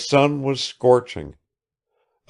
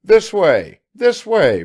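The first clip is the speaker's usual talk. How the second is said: high, loud call; loud, pitched-up beckoning shout; 12 semitones higher, 8 dB louder, slower than usual. A narrator's reading. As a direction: summon someone across the yard. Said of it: "calling out".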